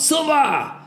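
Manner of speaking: high sharp yell; shouted call. A narrator's reading. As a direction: shout it as a demo. Sergeant shows the shout.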